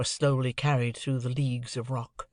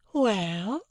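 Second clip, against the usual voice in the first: higher, rising questioning well; a lightly raised mock-innocent query; wide-eyed Estella; 8 semitones higher than usual. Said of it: "she opened her lovely eyes the wider".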